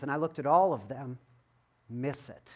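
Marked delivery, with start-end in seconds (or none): none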